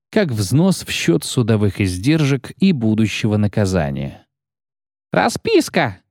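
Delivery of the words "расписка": high, slow shriek; shrieking clerk. Acted. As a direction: squeal the word out.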